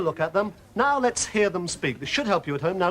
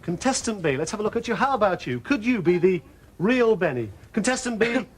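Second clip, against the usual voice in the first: ordinary talk, nothing marked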